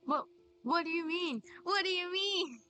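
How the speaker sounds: usual speaking voice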